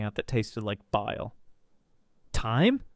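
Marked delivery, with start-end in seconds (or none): 2.3-2.8 higher, incredulous question; sharp rising echo of disbelief; icy retort. A narrator's reading. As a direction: shoot it back, rising sharply. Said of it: "I shot back".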